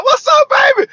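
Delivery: loud, high shout to a friend passing by; calling out. Real, not acted. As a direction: hail them across the lot, big and bright. Calling out to a passerby.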